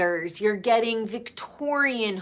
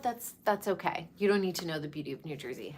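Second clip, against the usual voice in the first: softer and fast, tossed off under the breath; quick quiet aside; 3 semitones lower, 9 dB quieter, much faster than usual. Real, not acted. Vlogger shrugging off outsiders, fast soft aside. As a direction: throw it away quickly and quietly, almost to yourself.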